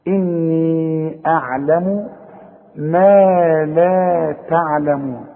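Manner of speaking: slow chant, long vowels in "إني" and "ما لا"; measured Quran recitation. Shown as a demonstration. Teacher recites the verse for learners, slowly chanted with held vowels. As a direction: recite evenly, stretching "إني" before "أعلم". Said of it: "ده مديها حركتين".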